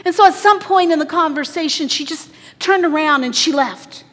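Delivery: loud, high, driving preacher voice; a preacher pouring it out over the crowd; preaching at full force. Acted. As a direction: push it out with rising fervour. Preacher continues driving retelling.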